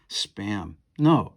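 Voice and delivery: ordinary delivery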